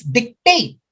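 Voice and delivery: TATE punched in "dictate", light first syllable; second-syllable stress. Shown as a demonstration. Teacher sounding out the answer, "dictate" stressed on second syllable. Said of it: "letter D is second syllable".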